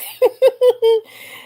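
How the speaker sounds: sudden high laugh; high burst of laughter. Real, not acted. Laughing at Rosie's comment.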